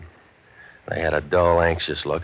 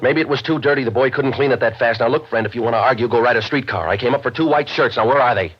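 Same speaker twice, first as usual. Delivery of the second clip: raised higher and louder, pushing back hard; heated argument; 6 semitones higher, 4 dB louder than usual. Acted. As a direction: lose patience and argue her down. Novak argues with the woman.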